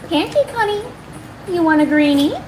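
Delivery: high, sing-song cooing to a pet; gushing baby talk to a cat. Acted.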